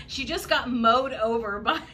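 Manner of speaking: usual speaking voice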